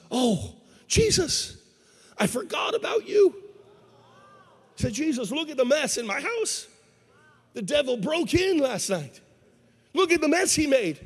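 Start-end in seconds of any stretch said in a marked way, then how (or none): 0.1-3.3 high-pitched, startled outburst, drawn out; startled realization. Acted. Man surprised to see Jesus upstairs, startled. 4.9-11.0 high, emphatic wail of complaint; distressed complaint. Acted. Man shows Jesus the wrecked house, distressed.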